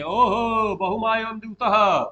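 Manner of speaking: projected, theatrical outcry; Duryodhana crying out. Acted. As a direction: stretch the "oho" and proclaim it. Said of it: "कह रहा चिल्ला के".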